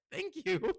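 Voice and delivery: high, loud retold squeal of thanks; surprised gratitude. Thanking a friend who dropped by unannounced, surprised gratitude. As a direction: burst out thankful, as if caught off guard. Acting out grateful surprise at visit.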